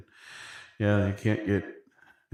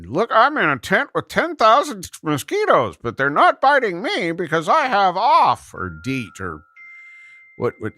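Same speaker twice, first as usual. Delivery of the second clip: weird dude voice; 9 semitones higher, 4 dB louder than usual. Retold or imitated. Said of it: "he was sitting".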